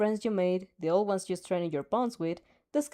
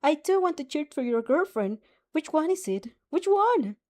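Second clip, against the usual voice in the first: grinning, eager; 7 semitones higher, 4 dB louder than usual. Acted. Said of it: "she grins".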